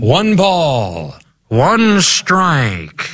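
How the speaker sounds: high, lilting radio play-by-play; old-timey radio voice. Acted. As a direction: call it like a vintage radio announcer. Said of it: "I'm gonna do an old-timey radio voice".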